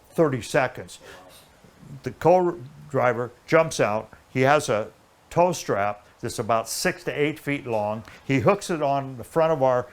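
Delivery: ordinary talk, nothing marked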